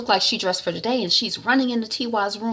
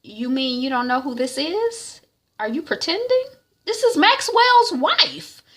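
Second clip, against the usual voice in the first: incredulous reveal; 10 semitones higher than usual. Retold or imitated. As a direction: spell out who she is, stunned. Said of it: "And T Y is like".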